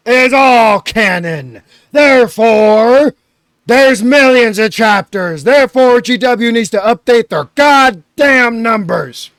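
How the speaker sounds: hammed-up indignant ranting; mock outrage.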